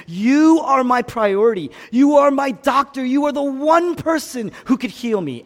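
loud, high desperate plea; crying out. Acted. Preacher voices Jesus pleading with his Father, crying out. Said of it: "When he cried out".

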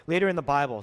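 ordinary talk

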